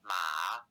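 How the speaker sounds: low "Ma" dipping, then rising; drawn-out dipping "Ma"; Mandarin third tone. Shown as a demonstration. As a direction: drop "Ma" low, then lift it. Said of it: "The word for horse is".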